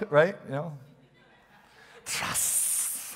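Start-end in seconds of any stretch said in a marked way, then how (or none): 2.0-3.1 breathy whispered hypnotic snake hiss; Kaa the snake. Retold or imitated. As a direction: hiss it like the Jungle Book snake luring you in. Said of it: "Jungle Book".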